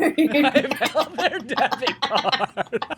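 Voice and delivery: slow, high, dissolving into laughter; laughing repeat. Real, not acted. Repeating her joke to her co-host while cracking up, laughing. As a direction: giggle through every word.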